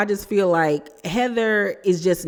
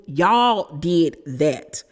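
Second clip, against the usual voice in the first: slow emphatic; much slower than usual. Real, not acted. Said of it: "repeated point".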